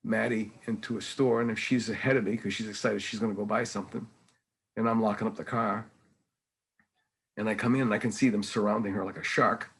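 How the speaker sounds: ordinary talk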